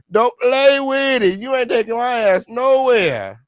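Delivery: high, loud and slow, daring them; defiant shout. Pretend. Loudly telling the police she won't go. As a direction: yell it like nobody's taking you.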